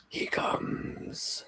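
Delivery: ominous, very soft whisper; voices in the head. Acted. Thousand eerie voices warning the player, a hushed whisper. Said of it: "a thousand voices inside your head".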